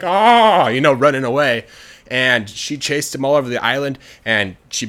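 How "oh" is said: higher, louder mock scream; drawn-out yell while fleeing; scared scream. Guy running away from the dragon, mock scared scream.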